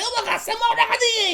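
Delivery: very high goblin squawk, exasperated; vehement, frustrated goblin yell. Acted. Goblin chef yelling at the newcomers, vehement and frustrated. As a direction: screech it in frustration, not anger. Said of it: "He starts yelling at you".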